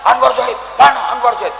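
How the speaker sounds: high, quick calling out; searching call. Acted. Preacher voicing God searching for him. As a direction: look around and call the name. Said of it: "Pokoknya Allah terus".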